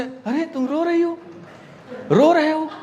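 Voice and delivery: high-pitched put-on concern; mock concerned partner. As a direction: fuss over them in a high voice. Acts concern at partner crying. (बनावटी चिंता, ऊँची आवाज़)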